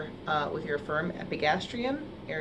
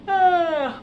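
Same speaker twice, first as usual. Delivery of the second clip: big yawn sigh; 11 semitones higher, 8 dB louder than usual. Shown as a demonstration. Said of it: "So not a big, like".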